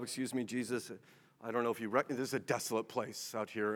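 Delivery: a hint of timid disciples, quiet and hesitant; softly and awkwardly point out the obvious; sheepish apostles. Preacher acts apostles interrupting Jesus.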